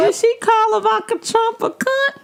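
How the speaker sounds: high, scandalized question; mock shock. Real, not acted. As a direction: act scandalized. Mock-shocked question about news.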